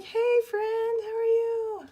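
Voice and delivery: very high, drawn-out hello; hammed-up, high-pitched chatty welcome; gushing friendly greeting. Acts out greeting friend on live chat.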